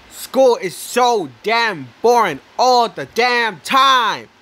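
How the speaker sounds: slow, furious yell about boring school. Real, not acted. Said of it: "I'm sorry about my yelling".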